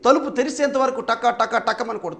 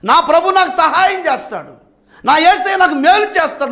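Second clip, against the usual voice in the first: confident declaration; 6 semitones higher than usual. Acted. Declaring faith with rising conviction.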